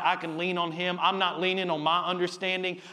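ordinary talk, nothing marked

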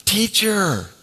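high, loud cry of recognition; cried out. Acted. Mary recognising the risen Jesus, crying out. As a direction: call out to him, overwhelmed. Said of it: "she cried out".

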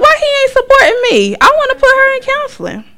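hammed-up squeal of outrage; high indignant rant. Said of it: "And I was like".

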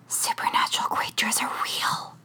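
hushed conspiratorial whisper; breathless secret shared in bed. A narrator's reading. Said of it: "she whispers".

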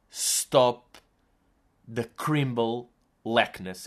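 slow, mangled English, words falling apart; garbled English. Retold or imitated. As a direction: stammer out "Stop the crimble lackness" in slurred broken English. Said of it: "eu deixei de conseguir dizer". (inglês atabalhoado e lento, a tentar dizer "Stop the fucking badness")

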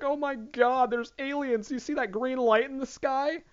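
high, breathless alarm; mock excitement. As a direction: gush it like a conspiracy believer. Mocking excited internet people.

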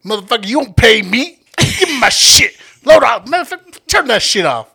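loud, high mock tirade; Julie. Friend imitating her yelling at a child, mock furious. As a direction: imitate a friend blowing up, shouting. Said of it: "Julie was like".

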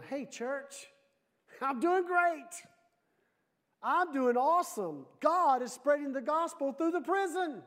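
high, loud triumphant cheer; upbeat Paul. Acted. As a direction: belt it out high, as if thrilled. Paul's upbeat letter, against sad one.

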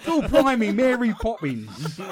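mock Cockney banter through laughter. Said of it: "East End there a little bit".

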